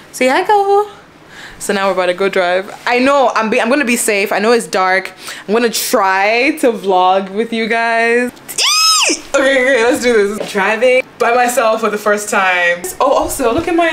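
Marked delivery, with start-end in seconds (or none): 0.1-0.9 high sing-song pet voice; talking to dog. Real, not acted. 8.3-9.2 very high excited squeal. Real, not acted. Squeals before first solo drive.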